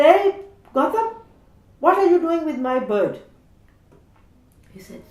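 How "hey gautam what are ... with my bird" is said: much higher and louder, demanding; an older boy barging in to claim his bird; bossy cousin. Retold or imitated. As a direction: cousin shouting at Gautam over the bird.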